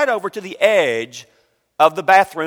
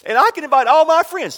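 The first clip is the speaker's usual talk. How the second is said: put-on little boy voice; 11 semitones higher, faster than usual.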